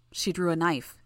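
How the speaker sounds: usual speaking voice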